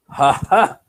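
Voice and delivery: short, high mock "ha ha"; imitated laugh. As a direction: act out someone's pointed laugh. Woman's triumphant laugh imitated.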